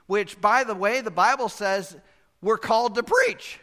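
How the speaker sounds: high ringing preacher's shout on "we're called to preach"; preaching loud. Real, not acted. Pastor to congregation, defending preaching, with a loud high ringing cry. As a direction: build to a full-force pulpit cry.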